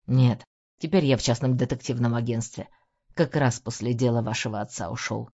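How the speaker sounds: lightly voiced wary man. A narrator's reading. Man character voice.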